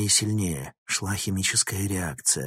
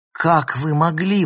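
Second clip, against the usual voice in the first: higher, accusing exclamation; indignant exclamation; 8 semitones higher, 6 dB louder than usual. A narrator's reading. Girl confronts professor over her stolen text, exclaiming indignantly. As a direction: exclaim in outrage, pitch jumping up. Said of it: "воскликнула она".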